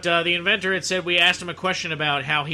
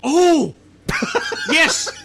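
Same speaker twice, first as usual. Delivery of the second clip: high, loud, laughing yelp; delighted squeal with a laugh; excited; 11 semitones higher, 5 dB louder than usual. Real, not acted. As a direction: burst out in delighted shock. Excited reaction to fried pizza.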